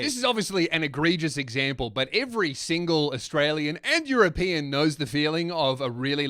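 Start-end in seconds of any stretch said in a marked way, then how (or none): none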